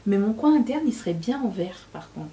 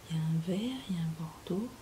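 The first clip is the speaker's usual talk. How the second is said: whispering; 3 semitones lower, 8 dB quieter, faster than usual. Real, not acted. Whispered while searching palette.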